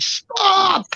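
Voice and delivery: high mock scream, held back in volume; retold screaming. People outside yell at him as he jumps, retold screaming. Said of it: "People are screaming outside".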